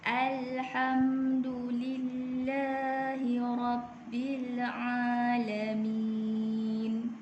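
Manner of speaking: slow, measured tajwid recitation; Quran recitation. Shown as a demonstration. Teacher recites the second verse for students. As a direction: chant it slowly with long held vowels. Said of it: "Sekali lagi".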